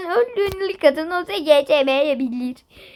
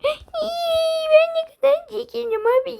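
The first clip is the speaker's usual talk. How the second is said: whiny lament; 9 semitones higher than usual. Pretend.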